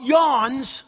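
usual speaking voice

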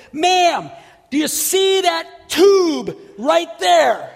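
slow, loud, high call; screaming over music. Retold or imitated. Preacher yelling to the woman over music. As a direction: yell into her ear over the music. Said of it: "I had to scream in her ear".

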